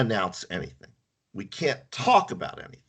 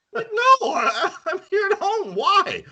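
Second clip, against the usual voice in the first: mock startled self.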